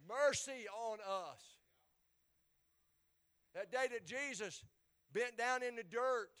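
ordinary delivery

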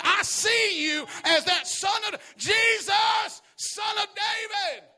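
very high, loud, slow proclamation; climactic shout. Retold or imitated. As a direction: crown it with a ringing cry. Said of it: "And so he said".

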